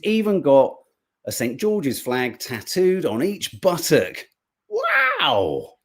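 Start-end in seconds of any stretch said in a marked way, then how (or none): 4.7-5.7 much higher, a squealed wow; mock amazement. Host reacting to the flag tattoo joke with much higher mock amazement. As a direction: squeal in fake astonishment.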